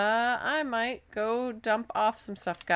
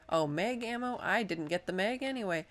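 normal talking voice

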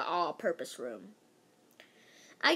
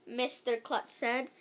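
plain reading voice

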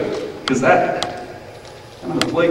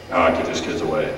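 snooty dismissal; 8 semitones lower than usual. Retold or imitated. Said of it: "That sounds kinda like the Pharisee".

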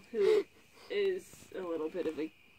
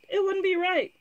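high, louder shocked protest; 12 semitones higher, 6 dB louder than usual. A narrator's reading. Prince to princess on refusing the match, shocked. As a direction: protest in scandalised shock, pitched well up.

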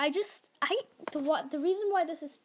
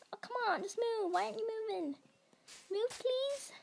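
higher, whiny plea for it to move; pleading with the dog; 8 semitones higher, faster than usual. Real, not acted. Begging the stuck game dog to move, high and whiny. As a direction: beg the dog to just move.

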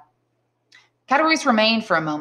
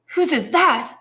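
much higher, wondering question; amazed; 10 semitones higher, 4 dB louder than usual. Acted. Father sees gold on the table, amazed. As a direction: ask in delighted amazement. Old man brightens at the gold.

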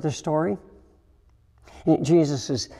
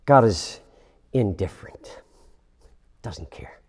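whispered, dropping to almost nothing; hushed, hollow whisper; bleak whisper; 6 semitones lower than usual. Retold or imitated. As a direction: breathe it out like a tired, hurt thought. Voicing a bleak view of God.

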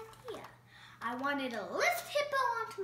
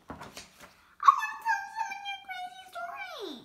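much higher, eager little voice; excited; 5 dB louder, faster than usual. Acted. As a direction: bubble over with wanting to tell. Piggy excited.

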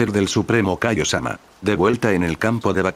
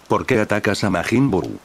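ordinary delivery

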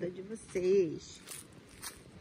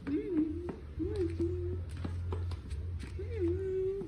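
rising cooing; 11 semitones higher, 3 dB louder, much slower than usual. Real, not acted. Cooing back at the dog.

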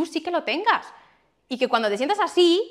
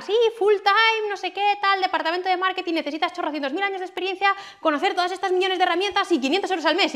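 mocking job ad; 7 semitones higher than usual. Mocking marketing job ads she browsed, sarcastic and high. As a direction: send up the absurd job offer.

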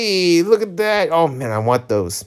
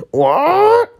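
startled squeak; 5 dB louder than usual. Acted. Startled reaction while reading.

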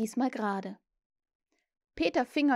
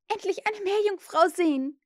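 put-on high, louder girlish longing; thrilled exclamation; 10 semitones higher, 5 dB louder than usual. A narrator's reading. Wendy dreams of seeing a mermaid, thrilled. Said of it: "rief Wendy".

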